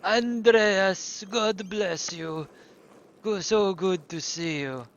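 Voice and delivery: slow, hammed-up Italian accent; mock Italian accent. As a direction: drawl out a fake Italian greeting. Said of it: "I know that's not really Italian".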